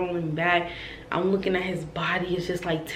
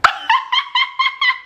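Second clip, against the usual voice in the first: high, loud staccato laugh; reenacted nervous cackle; nervous giggle; 5 dB louder than usual. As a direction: giggle fast and high, acting it out.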